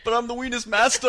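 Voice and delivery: high, loud boastful villain; grand villain voice. Acted. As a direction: announce yourself like a B movie villain. Joking self title.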